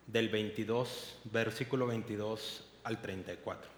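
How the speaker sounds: expressive storytelling read of scripture; dramatic scripture reading; Bible story narration. Acted. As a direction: read the Gospel like a vivid story to the congregation. Reads Gospel passage aloud.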